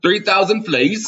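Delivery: hammed-up vendor astonished; mock disbelief. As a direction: gasp it out in comic shock. Improvised Renaissance fair scene.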